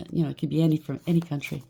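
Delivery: ordinary delivery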